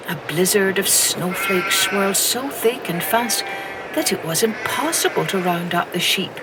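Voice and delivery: ordinary delivery, nothing marked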